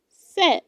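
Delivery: Said with a short e, like sed; correct pronunciation. Shown as a demonstration. Teacher repeating the model word: Said as sed. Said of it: "It is pronounced".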